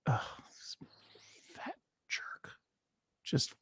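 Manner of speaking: breathy whisper under her breath; whispered insult. Real, not acted. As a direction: hiss it softly at the bird. Muttering at the mourning dove.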